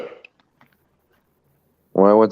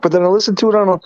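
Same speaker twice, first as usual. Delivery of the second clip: normal talking voice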